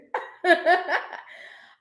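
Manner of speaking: loud, high giggle; real laugh. Real, not acted. Reader teasing Ash about his reading, laughing. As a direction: let the laugh burst out.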